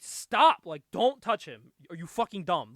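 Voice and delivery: play-acted alarm; urgent halt. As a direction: cut them off with a loud call. Villains halting someone, acted out.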